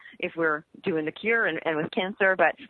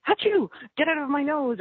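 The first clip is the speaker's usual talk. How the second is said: playful fake achoo, a bit higher; mock sneeze; 6 semitones higher than usual. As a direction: sneeze it out as a joke, then shoo it off. Mock sneeze demonstrating expelling.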